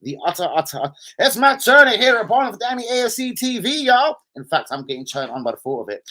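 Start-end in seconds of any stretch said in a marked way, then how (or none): 1.2-4.2 high, loud American announcer send-up; hammed-up American twang, pitched high; mock American accent. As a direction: take the piss with a big American accent. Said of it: "do it in an American accent".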